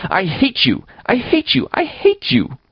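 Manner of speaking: very high, choked fury climbing with each repeat; child's shrieked tantrum; mounting hatred. A narrator's reading. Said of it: "with each assertion of hatred".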